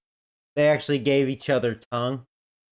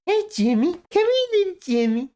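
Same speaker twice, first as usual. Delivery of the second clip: much higher, sing-song call to his little son; cooing dad voice. Retold or imitated. Dad calling his little boy over in a high cooing voice. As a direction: coax the kid over in a sugary falsetto. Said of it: "but he goes".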